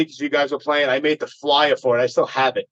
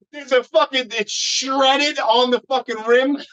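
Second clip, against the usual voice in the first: fake surprise; 10 semitones higher, slower than usual. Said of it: "I had to put on an act".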